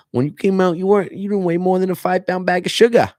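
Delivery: high, loud Maine accent imitation; a hammed-up Maine accent teasing a newborn's size. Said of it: "they'll talk like".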